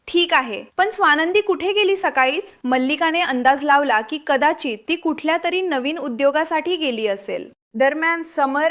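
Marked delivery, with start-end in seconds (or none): none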